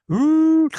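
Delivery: high, loud suction "ooh"; imitated suction noise. Shown as a demonstration. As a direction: hoot it high and loud like a vacuum. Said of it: "That's exactly what it sounded like".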